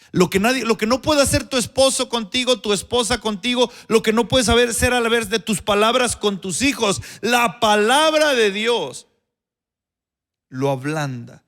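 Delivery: más fuerte y más agudo, cerrando lento; predicación al máximo. Acted. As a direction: remata el clímax con fuerza. Climax of the sermon. (clímax del sermón, con fervor)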